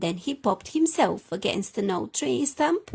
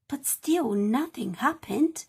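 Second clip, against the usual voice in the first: plain reading voice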